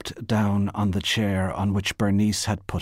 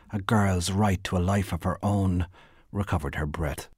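plain reading voice

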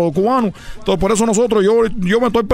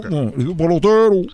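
"pelotero" lento, con la "o" final clara; el pelotero despacio; 3 semitones lower than usual. Shown as a demonstration. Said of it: "al último no". ("pelotero" pronunciado despacio, terminando en "o")

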